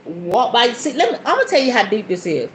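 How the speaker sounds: normal talking voice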